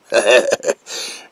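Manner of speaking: loud burst of cackling; loud, short villain cackle; short evil laugh. Acted. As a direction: laugh at the nervous patient.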